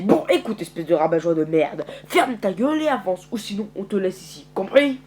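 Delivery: loud, forceful telling-off; angry scolding. Acted. Curtis threatens to leave Herschel behind.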